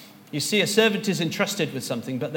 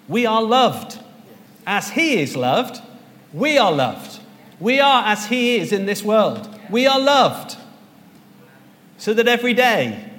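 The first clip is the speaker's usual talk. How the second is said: loud, higher, building declaration; preaching at full force; 6 semitones higher, 7 dB louder than usual. Acted. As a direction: proclaim it to the congregation, climbing louder. Preaching climax, loud and high.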